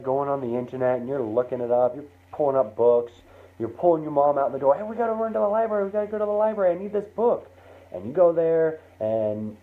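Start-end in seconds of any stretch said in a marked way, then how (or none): 4.7-7.5 high, rapid-fire eager pleading; excited kid. Acted. Kid pulling mom out to the library, excited. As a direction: pitch it up like a kid rushing mom along. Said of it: "and you're so excited".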